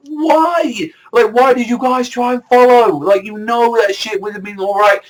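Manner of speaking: yelling at the characters in disbelief; exasperated outcry. Retold or imitated. Fan yelling at Chopper and Nami for following, exasperated high cry. Own frustrated reaction, I'm like.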